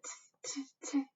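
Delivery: clicking tongue tsks; tut-tut like cheeky monkeys; whispered tongue clicks. Acted. Monkeys mock the peddler.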